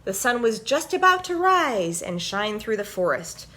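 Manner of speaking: usual speaking voice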